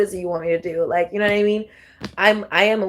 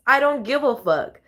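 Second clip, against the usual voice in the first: ordinary talk, nothing marked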